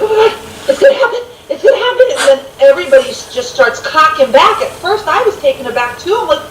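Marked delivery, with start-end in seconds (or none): 0.0-2.1 high-pitched giddy quote, laughing through it; excited squeal. Retold or imitated. Fan reliving the guns countdown, high laughing excitement. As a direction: squeal it like the moment is finally here.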